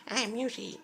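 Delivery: high squeaky cartoon dinosaur; Yoshi voice. Shown as a demonstration. Vlogger demoing a voice for viewers, high cartoon squeak. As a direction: pitch way up for a cute cartoon critter. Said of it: "voices ... like, Yoshi".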